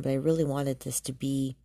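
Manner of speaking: ordinary talk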